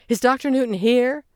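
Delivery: high, loud and anxious; desperate to find him; frantic question. A narrator's reading. Stressed young man asking the group, frantic. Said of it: "he asked frantically".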